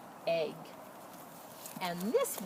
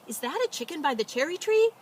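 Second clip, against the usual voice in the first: put-on quick, higher child-like question; a light touch of curious children; children asking; 9 semitones higher, 7 dB louder, faster than usual. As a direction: voice the farm kids pointing something out. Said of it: "she heard the farmer's children ask".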